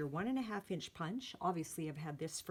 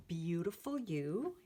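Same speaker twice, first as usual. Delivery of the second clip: ordinary delivery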